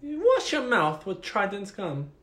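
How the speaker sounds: high, slow British put-on; mock British accent. Friend joining the accent bit, slow high mock British. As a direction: drag it out in a silly British accent.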